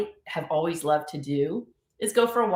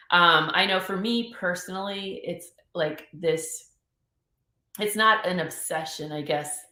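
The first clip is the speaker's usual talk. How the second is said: ordinary talk, nothing marked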